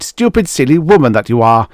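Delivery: loud, fast furious scolding; husband berating wife in fury; furious tirade. Acted. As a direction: rage at her, quick and loud.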